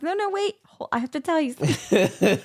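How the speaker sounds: imitating hysterical laughter. Patient reassures visitor while giggling, imitated hysterical laughter. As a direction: replay helpless laughing, squeaking the words out. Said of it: "hysterically laughing 'cause I couldn't".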